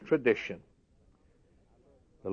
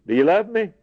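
pressing question; 7 semitones higher, 4 dB louder than usual. Retold or imitated. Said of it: "what our Lord said to Peter".

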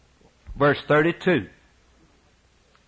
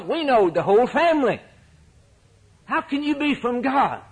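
mocking disbelief, rising higher to the end; scoffing townsfolk; 6 semitones higher than usual. Townsfolk mocking Jesus for claiming to be from God. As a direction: sneer at his claim.

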